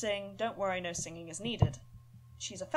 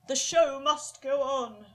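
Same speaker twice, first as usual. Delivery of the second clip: theatrical proclamation; 6 semitones higher, 10 dB louder, slower than usual. Acted. Theatrical declaration.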